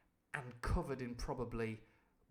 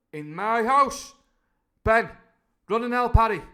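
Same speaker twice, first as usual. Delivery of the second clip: angry cry; 9 semitones higher, 15 dB louder than usual. Acted. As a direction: thunder it at the pub. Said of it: "cried Silver".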